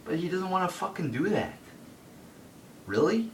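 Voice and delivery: angry disbelief; exasperated. Real, not acted. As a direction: rant at the screen in disbelief. Angry rant about Batista.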